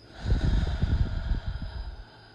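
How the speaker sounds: long, loud breathy exhale; audible, drawn-out sigh of release; deep breath sigh. Shown as a demonstration. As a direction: let out a big deep breath. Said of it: "taking a deep breath".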